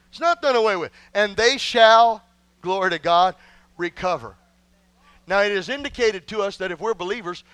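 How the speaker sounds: ordinary talk, nothing marked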